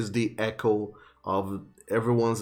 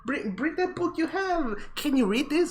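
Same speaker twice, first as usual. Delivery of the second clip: put-on very high squealing children's voices; high-pitched kids. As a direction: squeal with delight like little kids. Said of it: "they kinda do this, uh, high-pitched noise".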